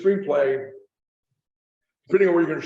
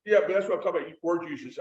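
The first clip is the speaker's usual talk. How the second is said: ordinary delivery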